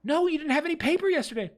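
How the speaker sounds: high and fast, desperate protest; upset visitor. Acted. Visitor protests to the clerk, high and desperate. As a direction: plead fast, voice climbing.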